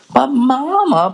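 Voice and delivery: high, drawn-out objection; a cub calling Mama out, slow and high; protesting cub. Acted. Sister protests.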